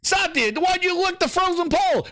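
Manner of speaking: ordinary talk, nothing marked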